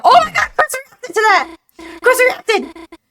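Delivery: high, loud thrilled gasp; excited squeal. Real, not acted. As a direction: burst out with delight. Thrilled at game character reaction.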